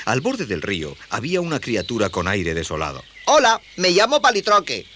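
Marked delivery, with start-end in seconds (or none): 3.2-4.9 put-on high, loud boyish hello; high-pitched, eager youngster; friendly boy. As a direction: introduce yourself in a high young voice. Boy introduces himself to creature.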